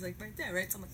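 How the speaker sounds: ordinary delivery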